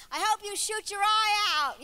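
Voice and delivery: loud, high, drawn-out wifely outburst; mock spite. Wife left behind while husband goes hunting, mock spite. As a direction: hurl it at him with comic venom.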